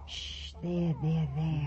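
soft, low comforting coo; soothing hush. Acted. Polly soothes the crying baby, soft and low.

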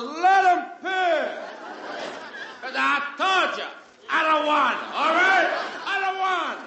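high, squabbling Brooklyn voices; arguing fans. Retold or imitated. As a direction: fans bickering over the pitcher.

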